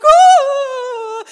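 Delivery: high, loud mock wail; child's wail. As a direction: imitate a girl bursting into tears. Daughter wails over found dog.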